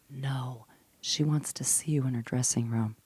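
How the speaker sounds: low, very soft quote; quiet, deadpan imitation of the manager; hushed retold reveal. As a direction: drop right down to deliver big news.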